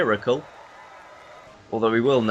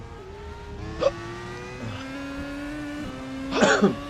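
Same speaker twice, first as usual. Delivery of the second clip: long hacking cough; coughing fit; 12 semitones higher, 6 dB quieter than usual. Real, not acted. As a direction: cough hard, catching breath. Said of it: "Sorry, I'm just trying to get my breath back".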